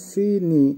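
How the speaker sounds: সিনি said carefully with a drawn-out first vowel, "see-nee"; pronunciation demo. Shown as a demonstration. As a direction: model সিনি as two clear syllables, "SEE-nee". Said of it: "উচ্চারণ হবে".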